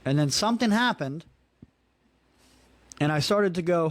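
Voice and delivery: normal talking voice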